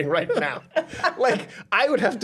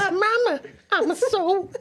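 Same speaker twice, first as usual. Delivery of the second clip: put-on high, slow little-kid whine; hammed-up distressed toddler; toddler impression; 13 semitones higher, much slower than usual. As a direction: whine to mama in a tiny voice. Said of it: "my great impression of the toddler".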